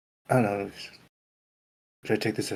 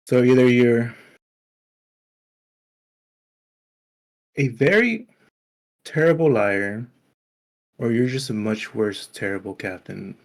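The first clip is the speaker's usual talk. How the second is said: mocking taunt; 4 dB louder than usual. Character taunts the captain.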